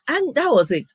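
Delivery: normal talking voice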